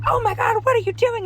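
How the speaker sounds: high, loud shocked outcry; imitating bystanders yelling in alarm; alarmed onlookers. Onlookers yelling at the driver who nearly hit a child, shocked and loud. Onlookers shout at near miss.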